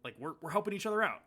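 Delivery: high, fast, rising plea not to shoot; panicked plea. Retold or imitated. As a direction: beg him not to pull the trigger, rushing. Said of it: "and he goes".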